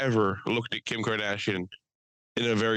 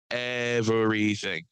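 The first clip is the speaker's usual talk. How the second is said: "Everything" stretched slowly over every syllable; drawn-out emphasis; much slower than usual. Real, not acted. Complaining that Walmart locks it all up, "Everything" drawn out. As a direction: draw out "Everything" for weight.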